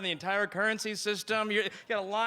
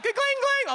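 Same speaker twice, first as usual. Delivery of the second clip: door chime; 5 dB louder than usual. Retold or imitated. Shop door bell.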